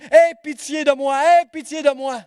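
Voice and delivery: high, loud desperate plea; pleading cry. Retold or imitated. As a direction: cry out for mercy with everything. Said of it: "il dit".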